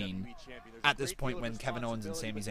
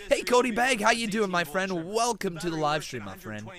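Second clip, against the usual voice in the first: excited greeting; 6 semitones higher, 5 dB louder than usual. Real, not acted. Greeting a viewer on stream.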